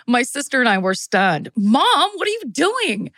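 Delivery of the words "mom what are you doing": put-on alarmed teenager. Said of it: "I have to give people different voices".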